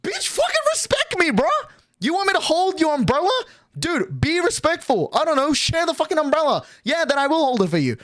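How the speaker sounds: put-on telling off the girl over the umbrella, heated; retold angry rant. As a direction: fire it back at her, indignant. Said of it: "And I'm like".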